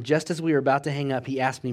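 usual speaking voice

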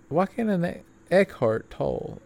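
Eckhart Tolle asked with a puzzled rising tune; puzzled about name. Real, not acted. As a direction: question Eckhart Tolle in disbelief. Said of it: "have the weirdest names known".

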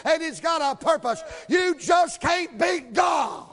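loud, high, rhythmic preaching ending in a shout; full-force preaching. Acted.

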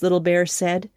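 ordinary delivery

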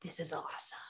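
low, hushed, far-off voice; a soft, eerie calm over her own crying; detached calm. Retold or imitated. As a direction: speak it quietly like watching from above. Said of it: "look down on her crying in the shower and say".

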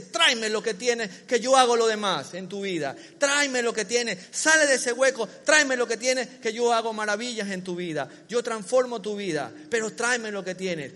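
loud, driving sermon plea; Jesus' voice urging the listener, preached loud; full-force preaching. Acted. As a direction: call them out of hiding.

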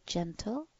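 soothing hushed "Gentle" to a dog; soft low gentle. Shown as a demonstration. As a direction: coax the dog softly with "Gentle". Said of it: "it's where my voice is".

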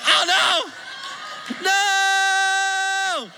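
high, loud panicked cry; a hammed-up yelp of dread; mock panic. Panicked reaction to police.

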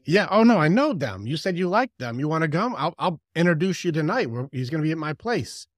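high, loud eager invitation; eager persuader. Acted. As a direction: talk someone into it, brimming with enthusiasm. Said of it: "They're like".

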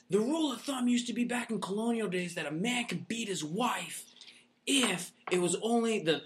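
high, lecturing voice of a woman scolding; lecturing lady. Retold or imitated. Movie lady scolding the brothers, high lecturing voice. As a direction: put on a preachy lady lecturing the men. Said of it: "And she's like".

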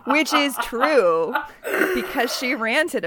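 talking through laughter, drawn out; slow, laughing agreement; real amusement. Real, not acted. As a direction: say it while cracking up. Listeners laughing at the quoted line.

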